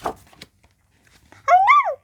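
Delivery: very high, loud squeak; high squeaky cartoon mouse cry. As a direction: squeak out like a tiny frightened mouse. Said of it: "squeaked Mouse".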